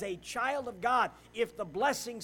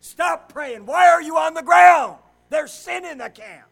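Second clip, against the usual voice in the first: booming voice of God; God shouting down at Joshua to get up; commanding shout; 6 semitones higher, 17 dB louder than usual. Acted. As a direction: bellow it like God ordering him up.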